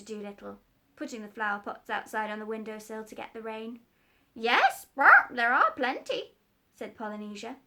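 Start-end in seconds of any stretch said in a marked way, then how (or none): 4.3-6.4 very high, loud parrot squawk. Acted. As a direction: screech like a bossy parrot.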